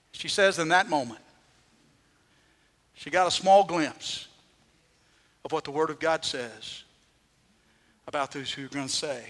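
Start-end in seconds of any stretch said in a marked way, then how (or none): none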